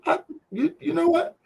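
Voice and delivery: normal talking voice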